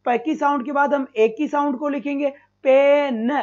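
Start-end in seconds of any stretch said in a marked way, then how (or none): 2.6-3.3 "पेन" stretched, final न set apart; teacher breaking "पेन" into its sounds; sounding out. Shown as a demonstration. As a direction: draw out the vowel in "पेन", then land on न. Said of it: "और फिर हम न की sound को लिखेंगे".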